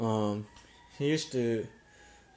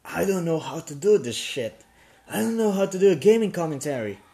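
higher, louder, fast frantic venting; retelling his nervous first commentary, frantic and loud; nervous panic; 6 semitones higher, 6 dB louder than usual. Retold or imitated. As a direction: blurt it out like a panicking beginner. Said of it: "I still feel very nervous".

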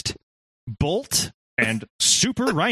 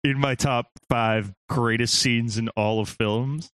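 normal talking voice

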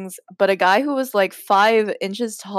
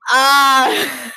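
loud, higher-pitched "aah" of cringing; exasperated wail; 5 semitones higher, 6 dB louder than usual. Real, not acted. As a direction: wail out loud at how bad it is. Groans at her own writing.

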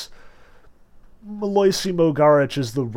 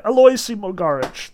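shivering; 7 semitones higher, 6 dB louder than usual. A narrator's reading. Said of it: "the man answered, shivering".

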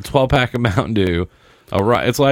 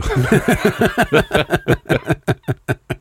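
high burst of laughter; 10 semitones higher than usual. Real, not acted. Laughing at own joke list.

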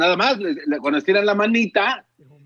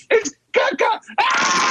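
mock kid wailing, much higher; kid crying out; much slower than usual. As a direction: squeal it like a shocked child. Niño grita en la historia. (niño chillando muy agudo, de broma)

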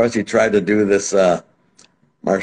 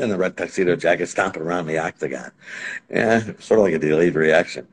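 ordinary talk, nothing marked

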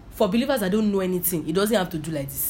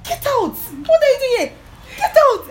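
much higher and louder, a fierce rebuke; commanding shout; 8 dB louder than usual. Retold or imitated. Preacher reenacting rebuking demons in her room, loud high shout.

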